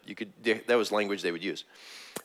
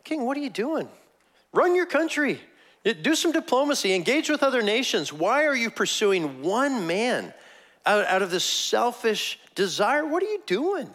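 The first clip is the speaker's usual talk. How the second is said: much higher, incredulous pleading; David's exasperated rebuke; 11 semitones higher than usual. Retold or imitated. David to the king hunting him, high exasperated rebuke. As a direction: scold the king in a high, baffled voice. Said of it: "So David's just like".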